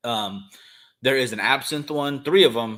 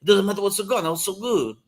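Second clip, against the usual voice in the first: badly faked Austrian accent, pitched up; terrible Arnold Schwarzenegger accent; 9 semitones higher than usual. Cigar host imitating a celebrity dipping cigars in tequila, terrible Austrian accent. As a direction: do a hammy, higher-voiced Austrian accent. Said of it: "that's a terrible accent".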